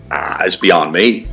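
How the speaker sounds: normal talking voice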